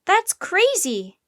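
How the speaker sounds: high, loud, stretched-out protest; slow high-pitched objection; yelling. A narrator's reading. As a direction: yell in disbelief. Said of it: "yelled Jack".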